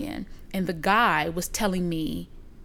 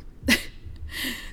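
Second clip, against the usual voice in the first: soft breathy chuckle; brief laugh to oneself; 4 semitones higher, 7 dB quieter than usual. Real, not acted. Laughs after describing affluent suburb.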